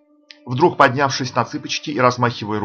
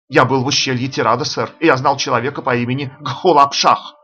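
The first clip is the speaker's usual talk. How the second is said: usual speaking voice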